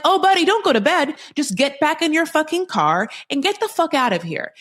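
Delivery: high-pitched indignant telling-off; mock confrontation. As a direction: send him packing, pitched high. Imagined telling-off of the man.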